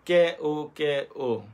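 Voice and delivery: each letter said apart, slowly; spelled out slowly. Shown as a demonstration. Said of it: "কিন্তু বানান করলে হবে".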